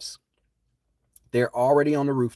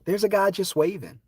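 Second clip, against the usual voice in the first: oblivious beachgoer; 9 semitones higher than usual. Retold or imitated. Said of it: "mimicking oblivious people".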